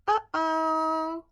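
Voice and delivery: a mild mock worry, slowing on it's broken; worried Emperor. Guessing the Emperor's thoughts for the child, mild dismay. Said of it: "he's going to be thinking".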